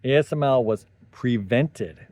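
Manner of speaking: normal talking voice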